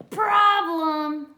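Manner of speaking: high, loud witch cry of problem; witch screech. Acted. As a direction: shriek it like a frantic witch. Witch character yells problem.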